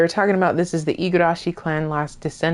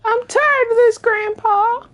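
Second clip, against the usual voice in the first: mock squeaky voice; 3 dB louder than usual. Mock character voice.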